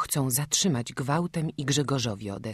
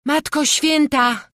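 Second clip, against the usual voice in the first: high, loud, slow indignant cry; angry exclamation; 13 semitones higher, 8 dB louder, slower than usual. Acted. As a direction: cry out in anger at the nonsense. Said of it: "zawołała Magda ze złością".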